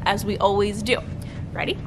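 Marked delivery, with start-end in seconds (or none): none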